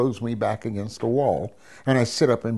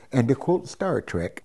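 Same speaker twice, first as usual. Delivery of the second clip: normal talking voice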